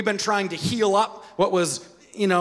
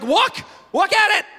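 whining believer; 7 semitones higher than usual. Retold or imitated. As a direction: plead like a toddler with God. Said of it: "we're like".

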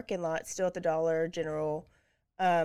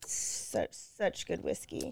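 hushed; 7 dB quieter, slower than usual. Real, not acted. Savoring whiskey, hushed.

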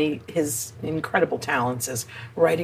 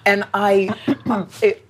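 ordinary talk

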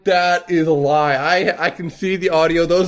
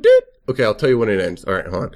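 usual speaking voice

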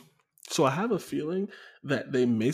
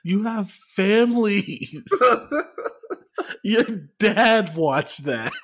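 slow, loud laughing taunt; 7 semitones higher, 7 dB louder, much slower than usual. Real, not acted. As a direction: drag it out while laughing. Mocking the losing team.

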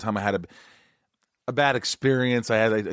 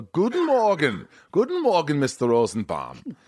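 high, slow German greeting; imitating Nico the driver; 4 semitones higher than usual. Mimicking his German driver greeting him each morning, high and slow. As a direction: sing out a cheery German good morning, higher and slow. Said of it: "Nico would pick me up and go".

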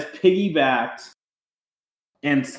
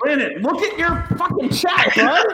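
laughing yell; 11 semitones higher than usual. Real, not acted. Host yelling at co-host.

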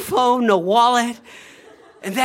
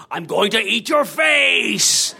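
slow, hammed-up monster shout; over-the-top monster; 3 dB louder, slower than usual. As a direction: go too far, gleefully menacing. Mummy escalates scaring kids.

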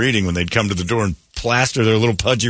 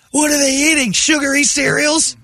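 put-on kid voice. Acting as the kid Brady.

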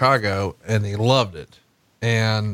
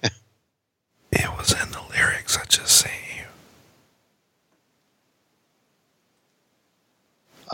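breathy stage whisper, slowed down; drawn-out whisper; 4 dB quieter, slower than usual. Pretend. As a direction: hush it like a secret lyric. Whispered song-lyric quote.

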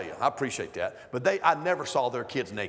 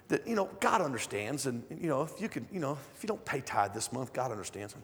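softly quoted excuse; 3 semitones lower, 7 dB quieter than usual. Retold or imitated. Teacher quoted excusing herself.

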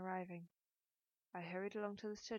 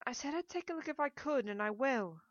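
higher, louder, mildly shaken promise; shaking voice; 6 semitones higher, 7 dB louder than usual. A narrator's reading. Woman resists the man's seduction, voice slightly shaking. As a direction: protest with a touch of unsteadiness. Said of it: "My voice shook".

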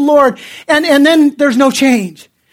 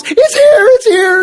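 a retold shout of joy, much higher and louder; excited shout; 8 semitones higher, 3 dB louder than usual. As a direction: burst in shouting the news. Running in with the check.

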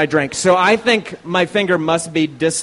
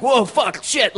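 higher, startled retold curse; 8 semitones higher, slower than usual. Cyclist reliving the moment a door swings open, startled. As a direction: blurt it out like the door just flew open.

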